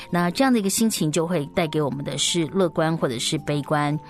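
usual speaking voice

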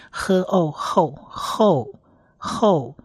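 喝 and 哦 blended into 后; sounds blended. Shown as a demonstration. Teacher builds the syllable for learners, blending 喝哦 into 后. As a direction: join 喝 to long 哦, making 后. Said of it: "长母音O的一个缩写".

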